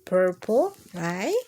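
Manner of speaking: ordinary delivery, nothing marked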